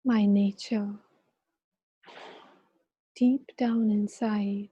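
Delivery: calm guiding voice with long pauses; guided meditation. Acted. Leads a meditation.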